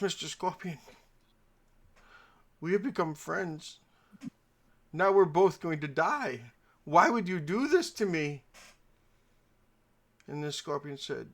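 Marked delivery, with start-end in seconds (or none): 0.0-8.4 higher, louder as the reproach builds; hammed-up dismay of a dying storybook frog; betrayed frog. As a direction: plead in mock betrayal. Stung frog confronts the scorpion.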